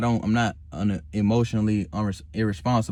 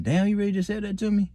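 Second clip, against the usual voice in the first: imitating a taken-aback supervisor; surprised; 8 semitones higher than usual. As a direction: sound caught off guard by the reply. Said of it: "he was surprised".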